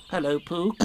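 Piglet. A narrator's reading. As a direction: greet lightly, as a small animal. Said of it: "said Piglet".

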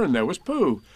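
ordinary delivery, nothing marked

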